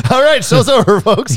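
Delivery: loud, high laughing sign-off; mock announcer. Ending the podcast segment, loud and laughing. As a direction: shout it like a showman closing.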